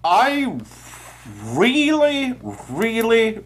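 high, gushing emphasis; excited. Real, not acted. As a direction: rave about it, pitch way up. Gushing about a film she loved.

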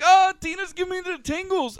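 high, loud swooning DJ croon; mock the DJ mooning over Tina; smitten DJ. DJ repeats his line.

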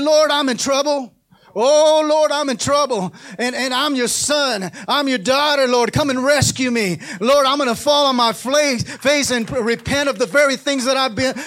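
loud, high, pleading cry to the Lord; preaching shout of desperate prayer; fervent prayer. Acted. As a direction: cry out to God for rescue. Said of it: "call on the King of Kings and say".

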